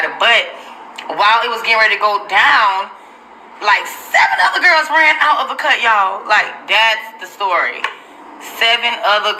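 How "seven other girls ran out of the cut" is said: higher, laughing as she blurts it out; cracking up at the surprise; laughing shout. Real, not acted. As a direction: laugh through the big reveal.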